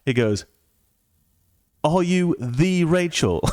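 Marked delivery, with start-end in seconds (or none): 1.9-3.5 "the" stretched out as THEE, slow and high; awestruck emphatic question. Retold or imitated. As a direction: draw out THE in starstruck disbelief. Imitating starstruck friend's question.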